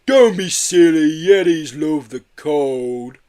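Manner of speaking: slow, far-off creature voice; Yeti voice. A narrator's reading. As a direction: drawl it out like a big gentle Yeti.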